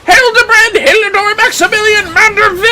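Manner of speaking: high, loud heroic declaration; voicing a triumphant cutscene hero shouting his name; grand proclamation. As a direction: announce the full name like a fanfare. Proclaims character's full name.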